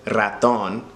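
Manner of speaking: raton with a trilled r at the start; raton, first r rolled. Shown as a demonstration. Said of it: "roll your R's in pretty much any word".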